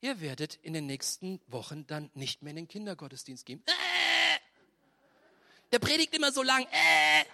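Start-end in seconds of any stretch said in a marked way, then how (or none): 3.7-7.2 put-on whiny teen. Teen mocked complaining.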